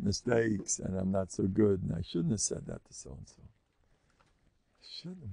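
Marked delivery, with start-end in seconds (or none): 3.4-5.3 barely audible, much softer inner voice; muttered self-reproach. Retold or imitated. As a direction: mutter it to yourself like a guilty thought. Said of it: "The brain chatter all day".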